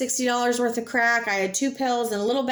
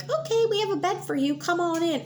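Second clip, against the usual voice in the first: much higher, sing-song cheery staff voice; mock rehab receptionist; 8 semitones higher than usual. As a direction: parody the too-easy welcome.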